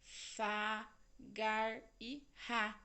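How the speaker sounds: "gar e ra" bem devagar, sílaba por sílaba; syllables spelled out. Shown as a demonstration. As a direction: soletre as sílabas com calma. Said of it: "palavra com três sílabas". (sílabas ditas lentamente)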